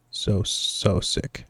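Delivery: slow, faint sick whisper; sickly whisper. Acted. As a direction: barely get the words out. Feeling sick, weak voice.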